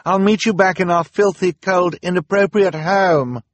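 high, loud call, slowing at the end. Acted. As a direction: call out firmly across the room. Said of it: "she insisted".

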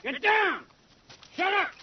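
sudden urgent yell, high; warning yell. Acted. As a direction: shout a warning to take cover. Officer senses an ambush.

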